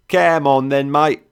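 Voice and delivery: high, loud London cockney banter; mock the red coat in thick cockney. A narrator's reading. Trader teases the soldier in thick cockney. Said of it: "in that thick London cockney".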